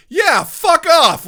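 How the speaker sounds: mock British outburst, high, loud and drawn out; Keira Knightley. Host retelling an actress's retort, loud mock anger. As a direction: imitate a blunt, yelled brush-off. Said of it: "two very different British responses".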